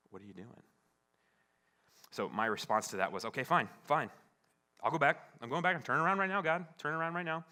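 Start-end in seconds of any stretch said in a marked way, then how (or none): 0.1-0.6 very soft, unhurried question; small gentle voice. Retold or imitated. God patiently questioning the husband, small and gentle. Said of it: "this really small, gentle voice". 3.3-7.4 loud, high, grudging retort; exasperated giving in. Retold or imitated. Husband grudgingly turning the car around, acted loud exasperation.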